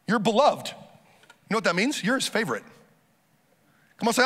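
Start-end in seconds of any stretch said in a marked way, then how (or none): none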